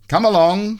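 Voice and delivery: high, loud and bossy; Mister Neat voice. A narrator's reading. Mister Neat hurries Messy off, high and bossy. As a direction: hurry him along.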